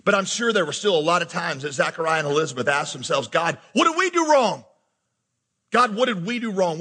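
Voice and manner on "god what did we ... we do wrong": high imploring question; pleading with God. Retold or imitated. Pastor voices Zachariah and Elizabeth questioning God, pleading. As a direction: plead upward, imitating anguish.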